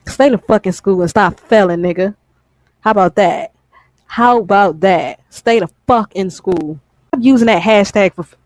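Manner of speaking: normal talking voice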